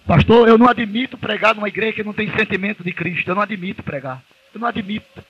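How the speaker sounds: high, insistent preacher cry on não admito; fervent preaching. Acted. Preacher refusing a church without feeling, high and insistent. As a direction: drive each refusal home from the pulpit.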